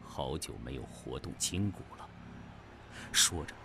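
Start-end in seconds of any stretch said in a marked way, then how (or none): 0.0-2.1 cool and offhand, low and soft; a casual challenge from someone unbothered; indifferent, understated. A narrator's reading. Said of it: "只是淡淡的说".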